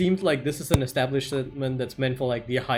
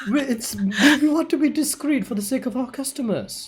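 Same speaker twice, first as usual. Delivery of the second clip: higher, louder laughing shopkeeper; 10 semitones higher, 5 dB louder than usual. Acted. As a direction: hold the role through laughter. GM laughs while in role.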